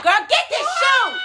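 high, loud, exclaimed reaction; excited outburst. Real, not acted. Listener reacting to her friend's revelation, excited outburst.

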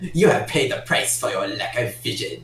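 lightly voiced sinister emperor, half-laughing; a hint of a film villain, chuckling; Emperor Palpatine. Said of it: "I'm literally paraphrasing Emperor Palpatine here".

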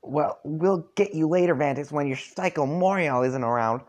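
high, loud bully voice; Darnak shouting. Acted. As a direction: yell it as a taunting troll bully. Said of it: "Darnak shouts at you".

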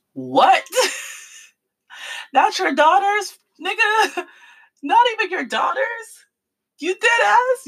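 high, laughing in disbelief. Real, not acted. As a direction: cackle at how absurd the tweet is. Mocking Terry's tweet, laughing.

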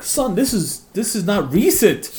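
imitated mechanic, high and loud; mechanic scolding. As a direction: lecture him like a stunned old mechanic. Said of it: "they're like".

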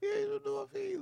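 soft, very high falsetto; mock girlfriend. Girlfriend accepting boyfriend's pampering, soft mock falsetto. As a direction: put on a quiet, cooing woman's voice. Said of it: "She's like".

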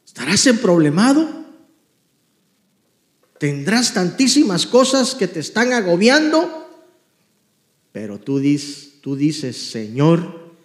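loud, high, impassioned sermon voice, stretching the sad lines slowly; fervent preaching. Acted. Pastor exhorting the congregation to sing again, full-force preaching. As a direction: cry it out to the congregation like a revival preacher.

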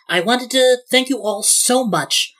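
high, gushing bride's welcome; effusive thanks. Acted. Bride addresses her wedding party.

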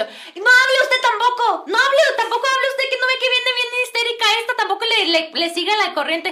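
high, loud urgent telling-off; scolding man. Retold or imitated. Man telling the girl not to answer back, loud mock scolding. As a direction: imitate frantic hushing at full pitch. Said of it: "luego ya me dice el, el muchacho".

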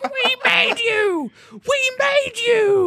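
very high, stretched-out imitation scream; hammed-up wail, each word dragged out slowly; mock yelling. Imitating the woman yelling until she dies, high mock scream. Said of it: "starts yelling at the, at the gun".

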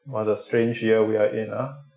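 ordinary delivery

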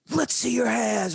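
high barked police command; cop shouting. Retold or imitated. Cop yelling at kids in a car, high loud shout. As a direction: yell it like a cop with gun drawn. Cops with guns drawn shouting.

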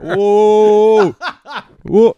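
loud, drawn-out, high whoa; excited whoa. Real, not acted. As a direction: whoop it out with a laugh. Cheering the podcast remark.

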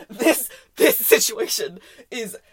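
laughing through the words, slowed; can't stop laughing while saying it. Real, not acted.

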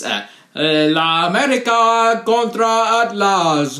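La America contra Atlas said in rolled Spanish, high and loud; Spanish pronunciation. Shown as a demonstration. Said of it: "I should say it's".

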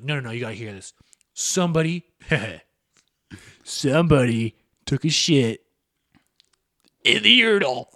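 mock stoner breaking big news. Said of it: "the biggest stoner in the school".

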